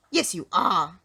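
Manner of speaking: loud, cross retort at normal pitch; snapping. A narrator's reading. Mister Uppity arguing with the goblin, snapping. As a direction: snap back firmly and loudly. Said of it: "snapped Mister Uppity".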